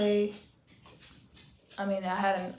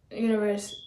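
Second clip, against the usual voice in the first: ordinary talk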